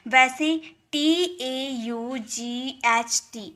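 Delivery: ordinary talk, nothing marked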